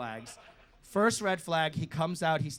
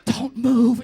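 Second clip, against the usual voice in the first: higher, louder frozen-stiff order; panicked self-order, much louder; tense loud command; 8 semitones higher, 12 dB louder than usual. Retold or imitated. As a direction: bark it at yourself in panic. Frozen in bed, telling himself.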